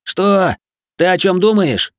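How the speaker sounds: high, loud questioning cry; bewildered shout. A narrator's reading. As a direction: yell back, not understanding. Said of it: "выкрикнул, не понимая, что происходит".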